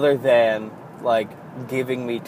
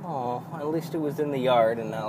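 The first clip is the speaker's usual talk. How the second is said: ordinary delivery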